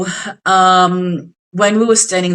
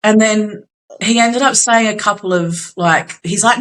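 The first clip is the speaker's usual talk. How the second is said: ordinary talk, nothing marked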